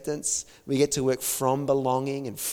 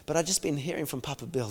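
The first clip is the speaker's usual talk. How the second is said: ordinary talk, nothing marked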